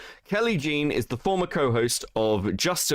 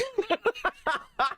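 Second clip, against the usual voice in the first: high laugh. Real, not acted. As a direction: cackle at the chaos. Laughing at Dan's rage.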